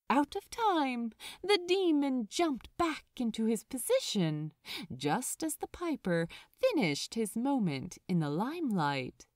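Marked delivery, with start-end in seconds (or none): none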